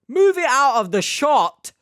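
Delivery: loud, high-pitched exasperation; exasperated outburst. Real, not acted. Complaining about a drink left on camera, exasperated shout. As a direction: raise the voice sharply in disbelief.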